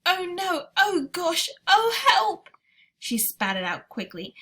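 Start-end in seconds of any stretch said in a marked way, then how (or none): 0.0-2.5 high, loud cries of disgust; a little girl choking on foul food, crying out; disgusted panic. Acted. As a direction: yelp it out as if she could retch. Sophie tastes the Snozzcumber.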